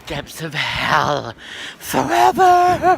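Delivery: loud furious rant at viewers; angry shout. Acted. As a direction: yell the curse, swelling at the end. Oh, I hate you all.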